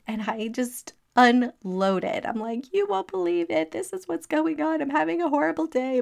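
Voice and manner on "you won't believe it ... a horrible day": much higher, rushed outpouring of complaints; excited unloading. Retold or imitated. Venting to her sister who called out of the blue, high and rushed. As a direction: spill it all out to your sister at once.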